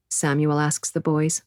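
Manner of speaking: ordinary delivery, nothing marked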